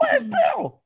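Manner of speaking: very high squeaky voice. Retold or imitated. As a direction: squeak it like a tiny puppet. Acted quote in high voice.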